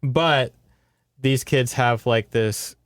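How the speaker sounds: ordinary delivery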